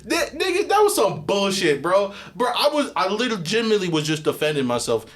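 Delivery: high, loud laughing rant; real laughter while ranting about the punishment; laughing outrage. Real, not acted. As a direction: vent while cracking up.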